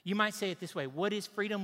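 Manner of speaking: normal talking voice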